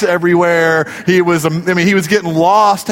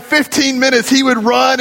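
usual speaking voice

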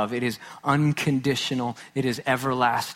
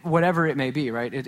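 ordinary talk, nothing marked

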